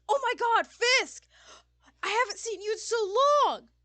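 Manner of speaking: very loud, high-pitched delighted cry; excited shout. Acted. Sullivan spotting the roommate across the bar, excited shout. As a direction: yell out high and thrilled at a long-lost friend.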